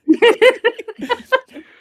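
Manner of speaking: shrieking loud laugh; big high laugh. Real, not acted. Player cracking up at Benjamin Gertrude. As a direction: burst out laughing.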